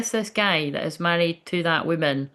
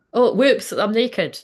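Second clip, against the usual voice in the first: higher, louder fake-innocent flirty gasp; mock coy whoops; 6 semitones higher, 5 dB louder, slower than usual. Mocking a flirty friend to the viewers, higher and louder. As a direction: play a coy flirt pretending it was an accident.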